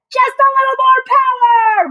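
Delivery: high, strained insistent push; insisting. A narrator's reading. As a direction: urge the machine on. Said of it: "Muckle insisted".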